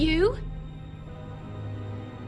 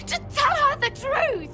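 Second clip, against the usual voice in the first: crying out desperately; 11 semitones higher than usual. Acted. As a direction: plead through tears at full voice. Desperate plea to Mira.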